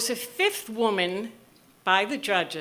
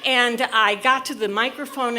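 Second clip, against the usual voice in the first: ordinary delivery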